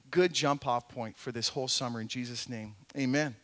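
soft, low, unhurried pastor's prayer; hushed, sincere church prayer; quiet prayer. Acted. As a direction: pray it gently to the Father, close to the mic. Pastor prays before the sermon.

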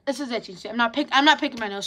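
normal talking voice

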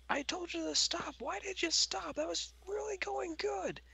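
wife voice. Acted. Wife demands why he stopped.

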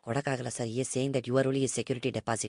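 low, soft, quick deferential patter; meek broker. Acted. Broker passes on owner's odd proposal, meek low murmur. Prank caller as broker Nagesh.

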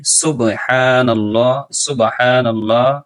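slow, drawn-out Arabic recitation. Shown as a demonstration. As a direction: repeat it slowly for learners. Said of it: "আবার বলে দিচ্ছি". (আবার ধীরে তিলাওয়াত)

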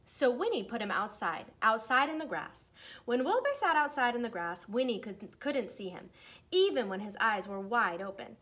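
lively storybook read-aloud voice; reading to children. Acted. Teacher reading a Halloween book to her class, lively read-aloud.

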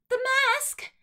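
high, loud alarmed cry; sudden panicked The mask!; surprised shout. Real, not acted. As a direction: yelp as it slips away. The mask about to fall.

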